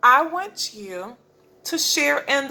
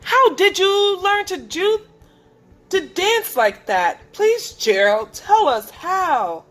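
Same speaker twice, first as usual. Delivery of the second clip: high, eager begging questions; eager pleading; 8 semitones higher, 4 dB louder than usual. Acted. Animals begging Gerald for his secret, high and eager.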